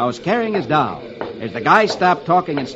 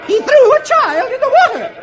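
high, loud shrill accusing voice; hysterical woman; 6 dB louder than usual. Acted. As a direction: shriek the accusation in panic.

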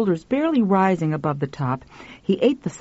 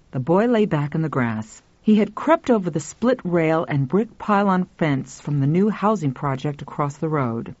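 plain reading voice

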